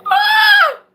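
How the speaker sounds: piercing high shriek; mock scream. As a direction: let out a shrill fake scream. Sudden scream over [name] oil.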